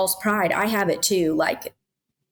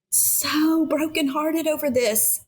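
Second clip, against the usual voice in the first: usual speaking voice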